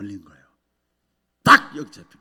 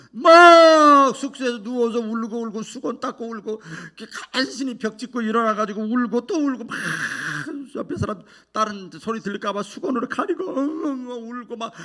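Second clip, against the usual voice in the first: tearful; 8 semitones higher, 7 dB louder than usual. Acted. Retelling weeping in lodging.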